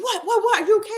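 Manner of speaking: high, laughing, alarmed mom voice; panicked mom. Retold or imitated. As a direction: imitate mom's startled panic.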